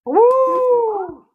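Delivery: high, loud wolf howl. Acted. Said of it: "Go on, Shadow. Get it out".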